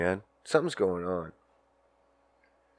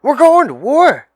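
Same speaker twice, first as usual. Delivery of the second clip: even higher, full-volume alarmed yell; brother shouting louder; 14 dB louder than usual. Retold or imitated. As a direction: push the news harder the second time. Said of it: "I said".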